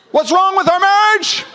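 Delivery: high, slow, hammed-up complaint; mock indignant whine. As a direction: squeal it like a wounded husband. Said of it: "I'd be like".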